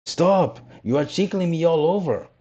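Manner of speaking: high, giggly little voice; laughing little voice. A narrator's reading. Log squealing at the carpenter's plane, laughing and high. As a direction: squeal it out while tickled.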